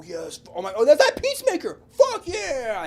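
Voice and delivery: ordinary talk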